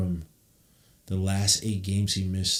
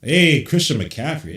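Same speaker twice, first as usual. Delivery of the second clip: calling out; 6 semitones higher, 7 dB louder, slower than usual. Real, not acted. Calling out to player.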